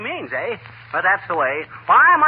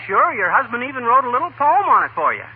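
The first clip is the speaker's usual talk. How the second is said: usual speaking voice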